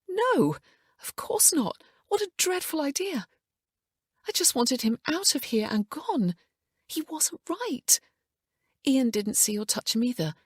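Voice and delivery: high, strained, brittle refusal; sharp recoil. A narrator's reading. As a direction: reject the idea in horror. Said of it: "Emma's reaction wasn't what she expected".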